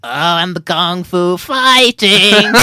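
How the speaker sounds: loud, drawn-out cartoon scientist; Professor Frink. Repeating impression on request, loud cartoon professor. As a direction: belt it slowly like a cartoon professor. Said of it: "Do it again".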